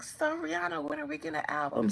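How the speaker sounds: high-pitched, hurried fan voice; pestering fans. Acted. Fans asking the singer for music, high mock-eager voice. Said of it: "Every time y'all be like".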